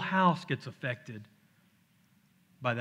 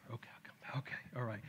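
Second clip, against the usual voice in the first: weary whisper; 3 semitones lower, 15 dB quieter, much faster than usual. Retold or imitated. Weary retold back and forth.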